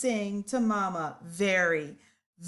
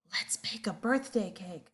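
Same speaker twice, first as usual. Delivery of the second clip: hushed whisper so Mama sleeps; breathy storybook whisper; 3 semitones lower, 6 dB quieter than usual. Acted. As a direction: whisper it, don't wake anyone. Whispering monkeys near sleeping Mama.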